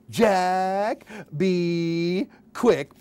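ordinary talk, nothing marked

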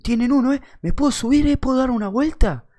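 high, eager put-on voice; mock voice of a drunk lad asking; imitated pestering stranger. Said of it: "Así, el loco".

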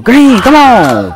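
long drawn-out hype call; drawn-out excited shout at the shot; hype yell. Real, not acted. Hyped green call in game.

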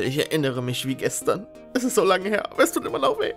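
usual speaking voice

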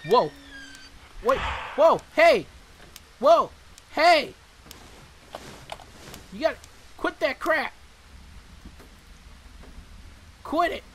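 high, loud yelling at the monster; startled shouts. Real, not acted. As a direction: yell at it to stop.

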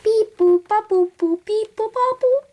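high, loud robotic beeps; alien talk. Pretend. To an imagined alien, high loud robot beeping. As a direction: chirp like a little robot alien. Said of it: "start doing alien talk".

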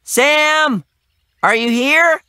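high calling yell; shouting. Acted. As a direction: shout into the trees.

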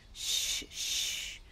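put-on calm the girl down with a shush; hushing. Doctor hushing the agitated girl.

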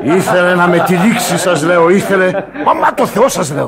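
loud, high bragging through laughter; loud laughing boast. Acted. Sailor roaring his tall tale to shipmates, loud and laughing. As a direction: swear to it loudly, laughing.

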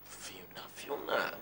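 hushed urgent whisper. Acted. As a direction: whisper her name under breath. Whispering to keep her quiet.

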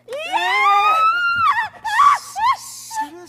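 very high, very loud drawn-out shriek; startled scream. Acted. Stranger bursting out of hiding, a shocked shriek. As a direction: let out a huge startled shriek.

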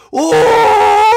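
a high, loud yelp while falling; loud high falling shout; falling yell. Acted. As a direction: cry out as you fall. Falling off the edge.

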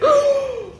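sharp high gasp of fright; sudden frightened intake of breath; startled gasp. Real, not acted. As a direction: gasp loudly at sudden lightning. Startled by a flash of lightning.

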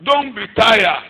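slow exhortation. Acted. Preacher exhorting congregation.

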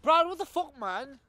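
high startled outburst; high shocked cry; horrified cry. Acted. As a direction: yell his name in shock. Discovers the watch again.

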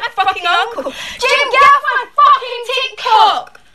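high, loud furious yell; angry yell. Real, not acted. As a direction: yell at the uncle to leave. Yells at uncle on TikTok.

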